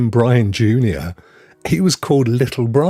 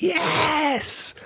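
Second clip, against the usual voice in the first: a high, cheering yell of delight; excited cheer. Real, not acted. Vlogger cheering to UK viewers about Universal Studios news, excited yell. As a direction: punch the air and shout it.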